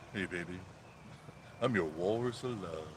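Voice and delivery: low, soft smooth-lover voice; hammed-up pillow-talk murmur; mock seducer. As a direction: croon it low like a joke Romeo. Jokey seductive crooner line.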